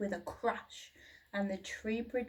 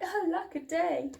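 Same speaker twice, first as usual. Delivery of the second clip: much higher, plaintive cry; wailing little dinosaur; 7 semitones higher, 3 dB louder than usual. A narrator's reading. Little dinosaur crying out when left behind. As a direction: wail it like an abandoned hatchling. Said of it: "he cried".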